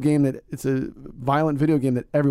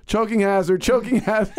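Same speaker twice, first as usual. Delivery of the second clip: put-on four-year-old shouting; 7 semitones higher, 4 dB louder than usual. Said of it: "He's always screaming it out".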